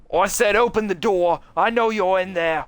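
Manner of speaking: demand, then menace; angry teacher. Acted.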